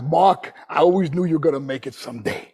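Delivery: put-on low Brooklyn accent, punched opening; hammed-up Brooklyn dad voice. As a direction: imitate a proud father from Brooklyn. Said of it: "my dad from Brooklyn, he goes, talks like this".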